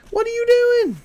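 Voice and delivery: very high, loud put-on dog voice. Owner voicing his dog at the viewers, high loud put-on voice.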